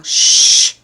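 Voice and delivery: a long unvoiced shh; shushing. Shown as a demonstration. Vlogger tells his racing mind to hush. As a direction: hush the thoughts firmly. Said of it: "I often tell myself".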